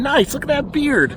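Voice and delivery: high cooing to the goats; high pet-talk voice. Real, not acted. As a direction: coo admiringly at the goat. Admiring goat's beard.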